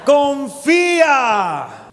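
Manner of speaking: preacher crying out the answer to the congregation; shouted command. Real, not acted. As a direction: stretch the word out as a cry to the crowd. Preacher's climactic single-word answer.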